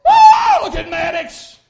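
high, loud cheering mom; proud parent shout. Retold or imitated. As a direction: call out high and loud from the stands. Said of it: "mom and daddy and all".

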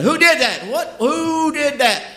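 high, loud furious demand; teacher whirling on the class, loud and high and furious; horrible angry voice. Retold or imitated. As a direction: roar it like a raging teacher. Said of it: "with a horrible sounding voice".

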